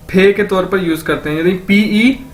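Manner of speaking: normal talking voice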